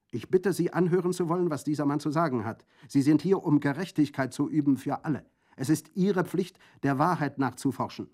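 loud, high, pressing appeal for justice; urgent plea. Acted. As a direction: demand to be heard. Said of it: "rief Colomba". (eindringlich und laut bittend)

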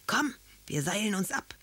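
rally a companion to join in; brisk, upbeat proposal; suggesting eagerly. A narrator's reading. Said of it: "schlug Karlchens Großvater vor".

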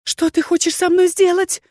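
high, fearful question; scared woman shrinking back; frightened. A narrator's reading. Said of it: "испуганно зашептала Валерита".

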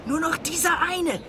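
strained excitement. Acted. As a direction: push through the last heave. Last body pushed out.

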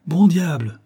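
usual speaking voice

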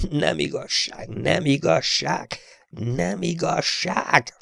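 slow, soft, rising despair; despairing echo. A narrator's reading. Dying gunner repeating it in his head, despairing. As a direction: let the words echo hopelessly. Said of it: "visszhangzott Ruskell fejében".

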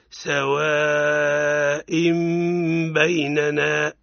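سواء بيننا with the tanwin turned to a nasal m; iqlab with ghunna. Shown as a demonstration. Teacher modelling iqlab for the class, slow recitation. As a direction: hold the ghunna before بيننا. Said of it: "يقرأ هكذا".

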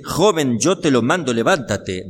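mandato firme, seguido, que arranca más agudo; orden tajante. Acted. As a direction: ordena con autoridad, sin pausas. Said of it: "Da una orden". (una orden firme y directa)

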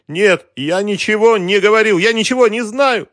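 high, loud panicked wail; desperate denial. A narrator's reading. Cornered captive denies all to his interrogator, desperate and loud. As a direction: cry it out strained and very high.

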